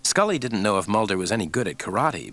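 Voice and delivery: ordinary delivery, nothing marked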